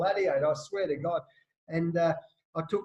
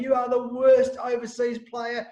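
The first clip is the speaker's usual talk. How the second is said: sledging; 6 semitones higher, 5 dB louder than usual. Retold or imitated. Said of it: "And Caddy's going".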